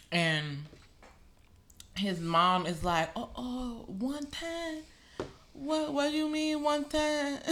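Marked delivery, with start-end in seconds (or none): 3.2-7.4 higher-pitched, softer attempt at a Haitian mother's accent. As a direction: imitate a Haitian mom, admitting you can't really do the accent. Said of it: "I don't know how to do a Haitian accent".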